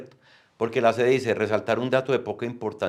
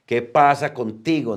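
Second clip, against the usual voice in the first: louder and higher, an effusive outburst on "¿Qué pasa contigo?"; raised exclamation; 5 semitones higher, 7 dB louder than usual. Shown as a demonstration. Teacher showing students exclamation marks, with a louder, higher voice. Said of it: "como se levanta la voz".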